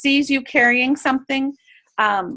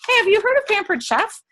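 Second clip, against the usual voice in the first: high, lifted Hey to open the chat; eager hello to a shopper; bright friendly greeting; 9 semitones higher than usual. Acted. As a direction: greet a stranger cheerfully. Acting out friendly stranger pitch.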